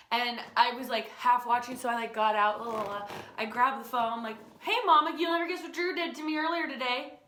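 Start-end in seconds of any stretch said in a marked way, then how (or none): 4.6-7.2 high, loud bubbly greeting; eager greeting. Retold or imitated. Girl greeting her mom on the phone, eager to tattle.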